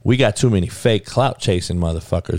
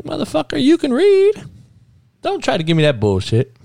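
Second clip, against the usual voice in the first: exasperated outburst. Real, not acted. Host calls out Perkins for mangling a name, exasperated high outburst. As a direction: blast him for making excuses.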